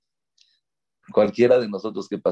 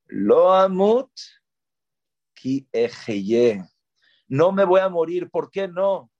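proclamación exaltada; 6 semitones higher than usual. Acted. Proclama no moriré.

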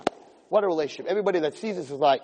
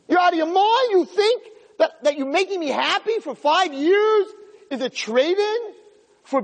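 outraged wife; 8 semitones higher than usual. Acted. Young wife berating her husband for hiding his illness, high indignant rant. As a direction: pour out the outrage at being cheated of a life.

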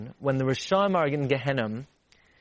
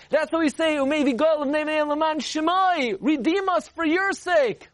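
fervent preaching; 10 semitones higher than usual. Acted. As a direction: preach it, calling out to Hashem. Preaching at full force.

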